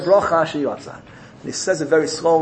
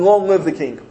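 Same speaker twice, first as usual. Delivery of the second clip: normal talking voice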